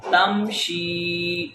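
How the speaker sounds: تمشی slow, final ee held; long vowel. Shown as a demonstration. Teacher drills ya madda, تمشی drawn out. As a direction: stretch out the end of تمشی. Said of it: "ہم اس کو لمبا کر رہے".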